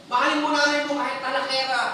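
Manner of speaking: normal talking voice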